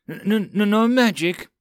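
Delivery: high, stammering panic; nervous stammer. Acted. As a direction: stutter it out nervously.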